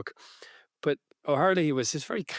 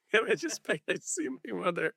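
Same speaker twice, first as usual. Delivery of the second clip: higher, laughing Dublin cabbie plea; laughing through quote; 8 semitones higher than usual. Retold or imitated. Retelling cabbie's plea to Bill, laughing. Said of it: "Dublin accent".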